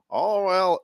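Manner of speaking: high, drawn-out country accent; botched attempt at country accent. Shown as a demonstration. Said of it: "now let's turn it country".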